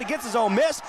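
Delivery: climbing pitch as the rebounds keep coming; excited play-by-play. Real, not acted. Frantic scramble under the basket.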